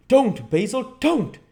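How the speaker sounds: very high loud desperate cry; frantic shouted plea; cried out. A narrator's reading. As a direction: stop him, panicked. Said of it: "he cried".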